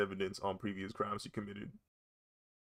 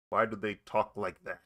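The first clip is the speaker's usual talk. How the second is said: ordinary talk, nothing marked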